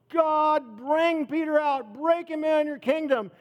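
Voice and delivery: high, loud pleading prayer; fervent prayer. Retold or imitated. Church praying for Peter, high loud pleading. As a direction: beg God over and over.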